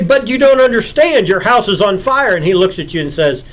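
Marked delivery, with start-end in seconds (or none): 0.0-2.4 high, insistent protest, easing toward the end; mock alarm, pitched up; acted urgent pleading. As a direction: plead to make him understand the danger. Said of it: "And you say".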